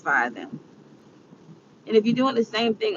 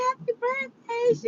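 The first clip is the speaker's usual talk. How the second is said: bright high greeting; 7 dB quieter than usual. Retold or imitated. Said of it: "I called and said".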